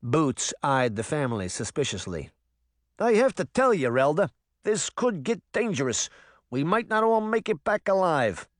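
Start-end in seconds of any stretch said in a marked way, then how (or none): 3.0-8.5 higher, jumpy New Jersey tough-guy voice; a nervous cat veteran in broad New Jersey; rattled New Jersey accent; thick New Jersey accent. Acted. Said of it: "in a thick New Jersey accent".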